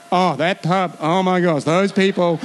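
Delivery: lightly voiced prissy disapproval; a hint of a judgmental churchgoer; mock snobby disdain. Preacher mocking a churchgoer who shuns the pub, mock disdain.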